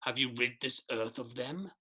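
low, soft echoing spirit voice; a hint of a ghostly voice from the dark.

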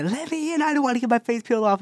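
mock panicked plea. Sister voiced pleading in panic.

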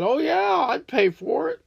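high squeaky mocking voice; hammed-up high mock fan voice; mock eager viewer. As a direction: sneer it as a sleazy fan. Mocking the crew's eager reply.

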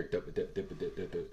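stammering on "dup" under the breath; Porky Pig. Retold or imitated. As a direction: repeat "dup" in a quick, soft cartoon stammer. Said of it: "I hear Porky Pig in my head".

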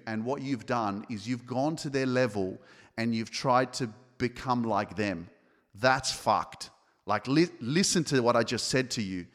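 loud, pushy pep-talk voice; fired-up podcast sermon; motivational preaching. Acted. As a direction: drive it home like a life coach. Motivational rant to listeners.

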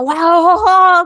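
high loud fake wow; mocking a jumping-up reaction. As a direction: burst out an exaggerated wow. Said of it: "reacciones como ... de saltar".

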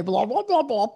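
lightly voiced alien gibberish. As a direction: babble like a cartoon alien. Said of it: "Alien impression".

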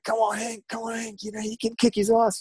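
high-pitched retold yell of encouragement from the escort boat; retold cheering shout. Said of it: "they were shouting".

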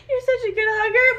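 high, quick quote through laughter; giggly high-pitched imitation; laughing mimicry. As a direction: rush out the gushing compliment, cracking up. Requotes friend while laughing hard.

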